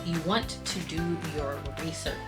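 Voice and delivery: normal talking voice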